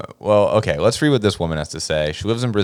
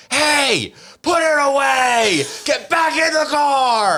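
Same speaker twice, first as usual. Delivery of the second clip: woman yelling; much slower than usual. Retold or imitated. Stranger scolds a man peeing in bushes, shouting. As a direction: yell it from far off like a stranger scolding. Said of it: "some woman yells".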